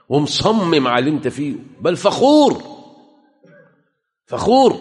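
shouted, high and loud; loud, high pulpit outcry; fiery cry. Real, not acted. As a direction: cry it out in condemnation. Condemning the proud.

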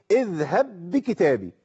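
اذهب بكتابي with the باء of اذهب merged into بكتابي, one doubled باء; slow, run the two باء together; merged reading. Shown as a demonstration. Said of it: "دخلت الباء في الباء". (إدغام الباء في الباء)

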